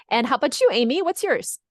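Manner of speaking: normal talking voice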